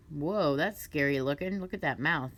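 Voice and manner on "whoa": ordinary delivery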